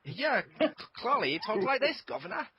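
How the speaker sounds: high, exaggerated English accent; over-the-top "governor" English accent; mock English accent. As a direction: put on a caricature English voice. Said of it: "you got the wrong English accent".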